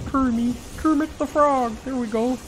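high, strained puppet frog voice; Kermit the Frog. Acted. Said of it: "He kinda talks like".

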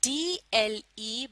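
letters drawn out slowly one by one; teacher sounding out D-L-E; slow spelling out. Shown as a demonstration. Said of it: "आप भी मेरे साथ पढ़िए".

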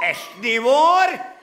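loud, high rising question; hammed-up high shouted challenge; demanding shout. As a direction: shout it upward for agreement. Comic music teacher demands agreement.

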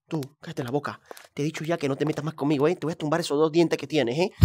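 low, soft menacing bully; bully threat. Pretend. Bully warning a classmate, low and menacing. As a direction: lean in and threaten him quietly.

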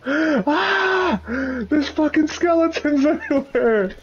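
high panicked yell; being chased. Real, not acted. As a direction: scream it while fleeing monsters. Chased by skeletons.

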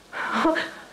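a nervous laugh out of shock. Real, not acted. Mother laughing in shock at the hospital. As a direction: laugh helplessly at the bad news. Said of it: "I keep laughing in shock".

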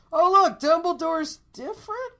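high, loud fake surprise; mock surprised. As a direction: act baffled at the new face. Mock confused viewer.

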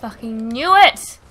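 slow, very loud outburst; exasperated shout. Real, not acted. Player caught by what they expected, exasperated shout. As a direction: drag it out into a shout.

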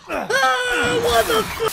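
high, loud shriek of fright; real startled yell, very high; startled scream. Real, not acted. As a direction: scream as if jump-scared. Jump scare in game.

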